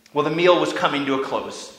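ordinary talk, nothing marked

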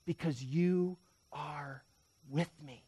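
soft, slow scripture; hushed assurance. Acted. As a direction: let each word settle gently. Psalm's answer, said as comfort.

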